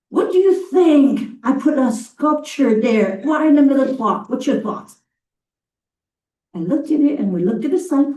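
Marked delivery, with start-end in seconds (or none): 0.1-5.0 eager question. Retold or imitated. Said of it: "He said".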